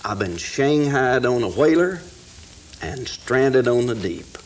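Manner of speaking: reciting a rhyming poem with rising energy; poem recitation. Acted. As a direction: build each line like a campfire recital.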